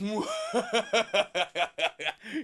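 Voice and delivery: hammed-up, villainous cackle; long mock-demonic laugh; Satan's evil laugh. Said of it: "Satan's there like".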